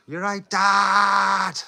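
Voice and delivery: high, long drawn-out shout; loud call. Pretend. Streamer playfully greets Dad, loud drawn-out call. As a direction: holler it with the last word stretched out.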